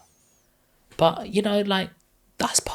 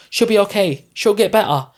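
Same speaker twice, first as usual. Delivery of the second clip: higher and louder, forced cheerful denial; mock reassurance; 8 semitones higher, 10 dB louder than usual. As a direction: loudly insist all is well to hide the fear. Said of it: "mask it to make themselves feel better and say".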